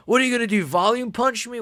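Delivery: high-pitched incredulous taunt; mocking challenge. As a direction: taunt them in a high mock voice.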